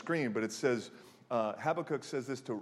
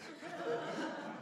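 short high giggle; high laugh; 9 dB quieter than usual. Real, not acted. Laughing at the tired boy, a short high laugh.

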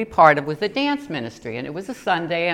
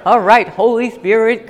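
ordinary delivery

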